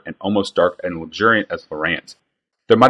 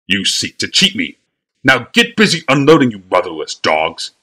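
angry sputter; 4 dB louder than usual. Acted. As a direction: spit the words at the boys in rage. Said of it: "sputtered Bly".

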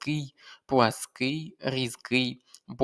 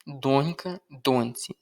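ordinary talk, nothing marked

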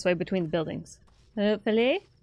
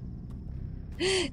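a high breathless gasp of delight; sharp gasp; 11 semitones higher, 11 dB quieter than usual. Real, not acted. Gamer spotting his new bull, sudden high gasp. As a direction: gasp as if seeing a treasure.

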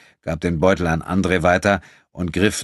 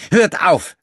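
high, loud commanding stop; imperious; 11 semitones higher, 5 dB louder than usual. A narrator's reading. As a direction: bark the order like the master of the house. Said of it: "sagte der Wirt herrisch".

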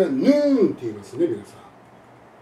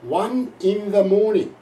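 slow, careful English, louder; correct English; 3 semitones higher, 4 dB louder, much slower than usual. Shown as a demonstration. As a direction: model the natural English phrase. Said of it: "あるいは英語ではですね". (正しい英語の発音で、ゆっくりはっきり)

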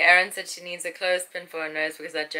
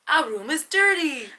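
drawn-out complaint; 7 semitones higher than usual. Acted.

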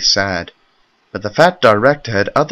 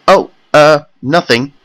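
stammering; 6 semitones higher, 5 dB louder than usual. A narrator's reading. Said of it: "Henry stammered".

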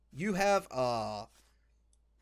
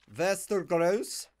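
play-acted Slavic border-guard accent; mock Eastern European accent. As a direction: roll it out in a hammy Slavic accent. Repeats name in mock accent.

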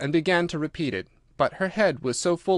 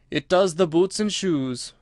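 slow, weighty pronouncement, pitch raised; very solemn; 5 semitones higher, slower than usual. A narrator's reading. Gryphon answers Alice's question, slow and solemn. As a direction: pronounce it with measured gravity. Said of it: "the Gryphon replied very solemnly".

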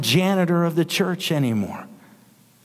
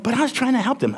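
lightly voiced daddy, pitched higher; a hint of a protesting dad, high; talking like their daddy; 8 semitones higher, faster than usual. Preacher retells talking like the kids' daddy, high protest. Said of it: "I'm talking like their daddy".

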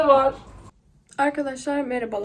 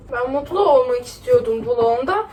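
usual speaking voice

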